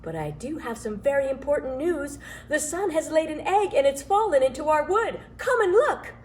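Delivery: bubbling over with big news; excited elf. A narrator's reading. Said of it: "said the elf".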